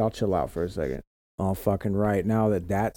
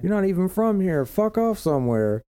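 raised louder comeback; high mock retort; 9 semitones higher, 3 dB louder than usual. Telling the transplants off, loud and high. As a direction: bark it back up high. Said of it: "And I'm like".

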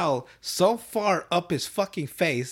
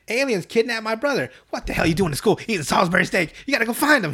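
higher, building louder outburst; mock flipping out; 7 semitones higher, 3 dB louder than usual. Cook learning of the kidnapping, mock freak-out. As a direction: panic that escalates into urging. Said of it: "He fucking flips out".

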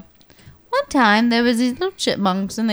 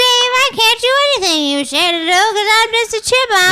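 chipmunk voice; 9 semitones higher than usual. Pretend. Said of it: "this is their story".

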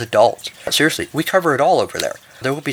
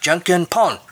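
higher-pitched game call, only lightly shouted; mild shout; 7 semitones higher, slower than usual. A narrator's reading. Two players throwing out their moves, mild shout. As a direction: call it out like a round starting, without full volume. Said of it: "Then they shouted".

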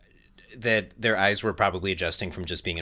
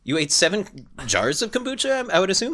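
high, disbelieving questions; incredulous soldier; 11 semitones higher, 4 dB louder than usual. Pretend.

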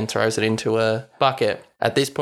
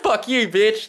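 high, giggling fuck you; mock bravado through laughter; host voices Rod hanging up, laughing; laughing insult; 10 semitones higher than usual.

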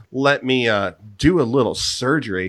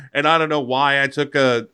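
ordinary talk

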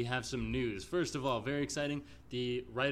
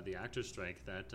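normal talking voice

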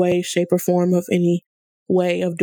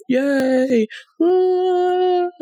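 high, drawn-out little whoop; celebratory cheer; 10 semitones higher than usual. Pretend. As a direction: cheer yourself on, stretched and higher. Cheers own year of therapy.

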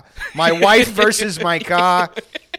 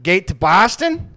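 put-on drunk Boston guy; 2 semitones higher, 6 dB louder, slower than usual. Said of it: "the guy's like".

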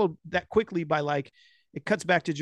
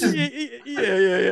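laughing yeah; 5 semitones higher, 3 dB louder than usual. Real, not acted. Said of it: "So good".

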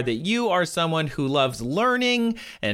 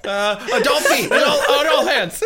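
laughing; 11 semitones higher, 4 dB louder than usual. Retold or imitated. Laughing, playing genie.

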